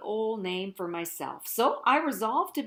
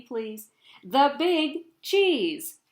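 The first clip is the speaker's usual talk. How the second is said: very slow, louder "the Big Cheese"; drawn-out title; 3 semitones higher, 5 dB louder, much slower than usual. Acted. Cheese boasting to listeners, slow and loud. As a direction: stretch each word like a proud title. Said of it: "say it with me".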